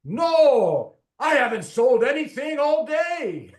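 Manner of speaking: loud, high indignant outburst, hammed up; emphatic Scottish retort. Bookseller answering mall security about selling books, emphatic mock outburst. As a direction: belt it out with heavy emphasis, drawing out the No. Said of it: "And in this Scottish accent, he goes".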